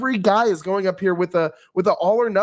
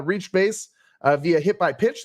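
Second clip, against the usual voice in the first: ordinary delivery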